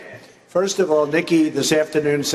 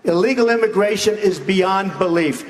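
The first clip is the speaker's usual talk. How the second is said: ordinary talk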